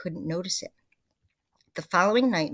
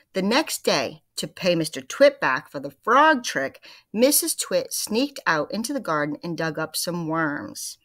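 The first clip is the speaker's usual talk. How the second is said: lively read-aloud to the class; story narration; 5 dB louder than usual. A narrator's reading. Teacher reading The Twits to her class, lively narration. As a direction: read it with energy for the kids.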